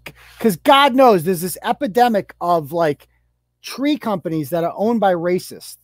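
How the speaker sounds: usual speaking voice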